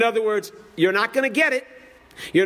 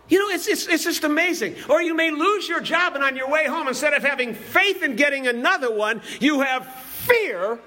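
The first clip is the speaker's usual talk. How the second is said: usual speaking voice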